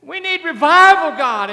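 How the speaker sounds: high, loud desperate cry to God. Retold or imitated. People in the prayer meeting crying out loud to God. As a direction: wail it out, begging for revival. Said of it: "they're crying".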